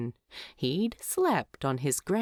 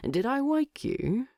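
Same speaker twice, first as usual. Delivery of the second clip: innocent question; 7 semitones higher than usual. A narrator's reading. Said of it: "Thorin said innocently".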